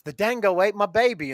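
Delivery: high-pitched imitation of an Aussie movie line; hammed-up Australian cry; mock Australian accent. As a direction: quote it in a put-on Australian accent. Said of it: "and then the guy goes".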